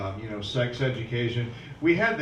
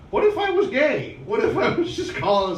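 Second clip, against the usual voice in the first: higher, louder incredulous protest; why would they say that, raised and indignant; indignant outburst; 9 semitones higher, 5 dB louder than usual. Real, not acted. Comedian protesting to the crowd, loud and incredulous.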